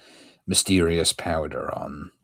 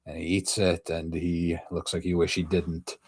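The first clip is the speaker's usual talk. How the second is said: ordinary delivery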